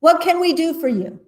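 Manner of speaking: high, loud chief-frog greeting; projected, high-pitched formal welcome; speaking up. A narrator's reading. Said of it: "spoke up".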